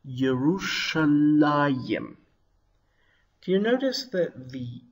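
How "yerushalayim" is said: "Yerushalayim" drawn out slowly, ending la-yim; Hebrew pronunciation. Shown as a demonstration. Teacher shows how the city's name is pronounced, Hebrew pronunciation. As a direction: stretch "Yerushalayim" slowly, syllable by syllable. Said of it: "and pronounced".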